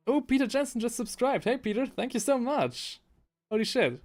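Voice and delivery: high-pitched delighted thanks; excited surprise. Real, not acted. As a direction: pitch shooting up in real excitement. Thrilled thanks for a new subscriber.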